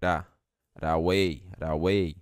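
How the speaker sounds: ra away, T of right turned to a tapped R. Shown as a demonstration. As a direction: tap the T into ra away. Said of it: "vai ficar com som de R e vai falar ra".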